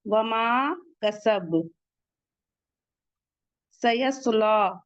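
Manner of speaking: वमा कसब recited very slowly, ending on a stop; slow recitation. Shown as a demonstration. Teacher models the stop on वमा कसब. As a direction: hold वमा कसब, then stop on the last letter. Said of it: "last में इस पे सुकून आ जाएगा".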